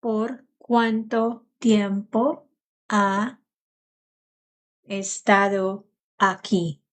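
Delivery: slow, careful Spanish; teacher modelling Spanish slowly; slow model phrase. Shown as a demonstration. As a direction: spell it out slowly for learners. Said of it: "And in Italian, you would say".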